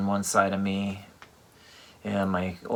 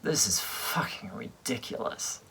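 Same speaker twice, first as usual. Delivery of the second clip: unvoiced whisper, fully breathy; inner voice whisper; 6 dB quieter than usual. Thinking it at grandma's open casket, whispered. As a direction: hiss it under your breath in disbelief. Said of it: "That part of me said".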